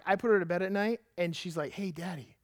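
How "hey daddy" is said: mock whisper. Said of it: "she whispers".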